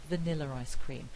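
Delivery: "Vanilla ice cream" run together as "Vanilla-r-ice cream"; intrusive R. Shown as a demonstration. Lecturer repeating the odd-sounding example, intrusive R linking. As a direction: slide an unspelt R between "Vanilla" and "ice". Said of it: "This sounds really odd to anyone".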